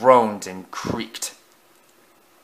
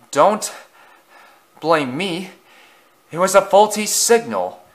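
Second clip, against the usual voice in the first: huffing, out of breath; 7 semitones higher than usual. A narrator's reading. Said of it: "Edward huffed out of breath".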